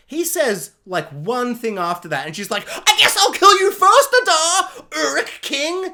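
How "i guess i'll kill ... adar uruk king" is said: mock fury. Galadriel threatening Adar, mocked.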